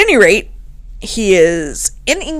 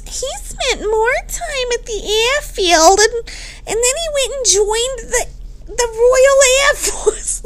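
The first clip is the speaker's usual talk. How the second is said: high, whiny put-on caricature; mocking ex-wife voice; 14 semitones higher than usual. Host mocking Charlotte's divorce complaint, high put-on voice. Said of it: "I don't know why I'm giving her that voice".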